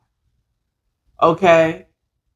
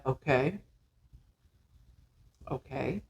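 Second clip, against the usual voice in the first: stunned quiet okay; 6 semitones lower, 15 dB quieter than usual. Retold or imitated. Stunned man quietly accepting.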